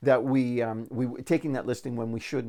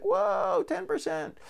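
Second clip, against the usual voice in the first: amazed whoa; much slower than usual. Retold or imitated. Class amazed at ten percent.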